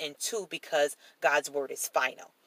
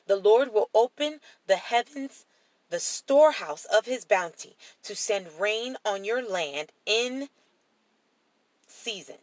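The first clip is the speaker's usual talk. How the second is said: higher, louder declarative Bible reading; raised reading voice, phrase by phrase; emphatic scripture reading; 4 semitones higher, 3 dB louder than usual. Acted. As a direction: proclaim the verse with deliberate pauses. Rereads verse with more force.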